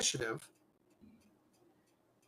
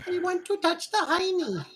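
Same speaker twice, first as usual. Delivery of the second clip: very high goofy squeal; hammed-up high-pitched chant; silly high voice. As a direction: squeak it out as a joke.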